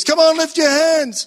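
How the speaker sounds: high, loud upbeat worship leader; rally the congregation like a song leader. Retold or imitated. Imitating the song leader urging the crowd to raise hands, high and loud. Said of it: "the song leader says".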